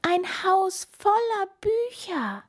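high, loud delighted squeak; tiny voice full of delight; beaming mouse. A narrator's reading. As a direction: call friends over as a thrilled little mouse. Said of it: "strahlte die Maus".

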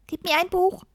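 high, lisping little furry creature. A narrator's reading. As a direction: squeak out the demand with a lisp. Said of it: "lispelte das Pelzschwein". (hoch und lispelnd)

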